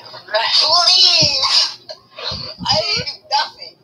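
high, whiny mock pleading; mocking imitation. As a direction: wail it like someone begging innocence. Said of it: "He's like".

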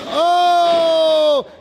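a quick, high "oh"; surprised yelp. Real, not acted. Commentator catching a risky move, surprised yell. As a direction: gasp it out as the move goes wrong.

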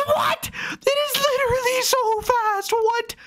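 very high-pitched disbelief; amazed cry, pitch way up; astonished squeal. Real, not acted. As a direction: squeal it, stunned by the speed. Astonished during drag race.

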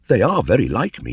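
put-on high, loud boyish voice; a boy marvelling at people; young Mowgli. A narrator's reading.

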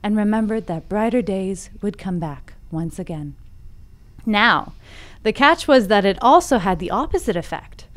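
softening, measured fable storytelling; story narration. A narrator's reading. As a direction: bring the fable to a quiet, deliberate close. Reads the fable aloud.

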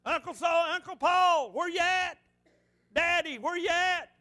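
put-on high, loud call of a lost boy; a lost boy calling for his uncles and his daddy; hollering for help. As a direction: yell out for your family in the woods. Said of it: "I started hollering for help".

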